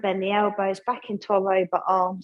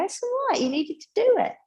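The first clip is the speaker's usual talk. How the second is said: much higher, light reassuring friend voice; kind friend; 13 semitones higher than usual. Retold or imitated. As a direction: play the forgiving friend, pitched way up. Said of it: "They go".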